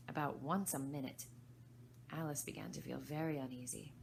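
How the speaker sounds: ordinary delivery, nothing marked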